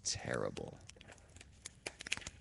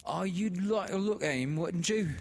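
much higher and louder, wheedling; sly sister; 14 semitones higher, 7 dB louder than usual. A narrator's reading. Sister leads Laura to the dead man, much higher and wheedling. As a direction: coax her with sly eagerness. Sister offers to show body.

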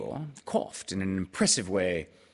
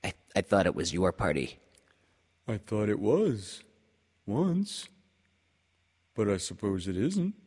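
Pooh voice. A narrator's reading. Pooh disappointed.

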